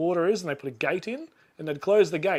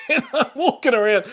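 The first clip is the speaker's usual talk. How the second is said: laughing; 9 semitones higher than usual. Real, not acted. Laughing while recounting Father's Day.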